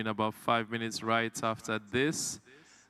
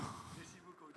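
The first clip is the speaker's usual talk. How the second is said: "Merci beaucoup" breathed unvoiced, barely audible; whispered French thanks; 22 dB quieter than usual. Host echoes his French joke in a whisper. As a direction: repeat it as a soft aside whisper. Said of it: "I know how to speak French".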